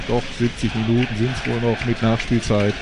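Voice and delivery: ordinary talk, nothing marked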